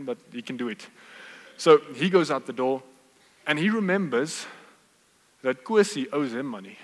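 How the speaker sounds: normal talking voice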